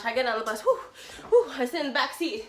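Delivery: normal talking voice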